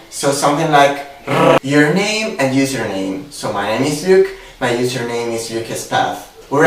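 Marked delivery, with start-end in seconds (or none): 1.3-1.6 a rolled, trilled "r"; strong Italian r. Shown as a demonstration. Teaching the strong Italian r sound, rolled. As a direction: roll the "r" hard like an Italian. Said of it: "our R sound, which is a really strong one".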